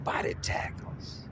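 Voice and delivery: hushed whispered words. Pretend. Narrating the opponent's charge, he whispers for suspense. Dramatic hush in fight commentary.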